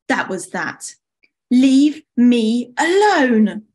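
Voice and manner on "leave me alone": loud, higher, slow and drawn-out; angry outburst. Acted. Summer snaps at watching friends, angry drawn-out shout. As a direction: push each word out forcefully.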